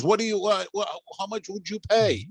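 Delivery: high-pitched pushy seller voice; high, pressing seller asking his price; Denise the seller. Retold or imitated. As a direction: pitch her up as she haggles. Seller Denise haggling, voiced by teller.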